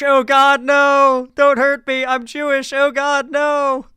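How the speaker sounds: loud, high, hammed-up pleading yelp; mock his fake terror, loud and high; whiny fake-victim cry, mocking; mock scared scream. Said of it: "Did you hear him scream".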